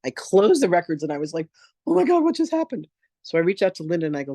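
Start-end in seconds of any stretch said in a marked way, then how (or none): 1.9-2.9 much higher panicked cry with a strange voice; terrified. Retold or imitated. She slams the records shut, panicked high cry. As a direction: gasp it out in sudden fright. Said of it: "I'm terrified out of my mind".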